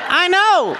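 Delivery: put-on high, loud kid voice calling out; little son's voice. Dad retelling his son warning his sister in a high child voice. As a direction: play the small boy warning his sister. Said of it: "he's like".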